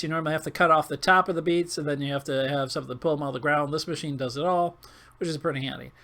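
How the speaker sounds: normal talking voice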